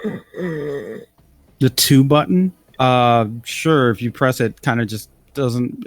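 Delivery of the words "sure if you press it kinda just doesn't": ordinary talk, nothing marked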